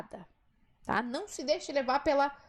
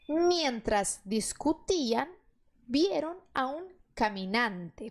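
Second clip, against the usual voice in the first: slow reading; slower than usual. Shown as a demonstration. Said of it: "reading the Spanish text slowly".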